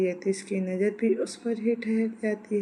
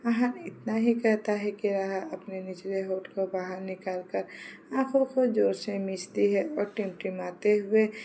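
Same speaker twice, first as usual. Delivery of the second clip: ordinary delivery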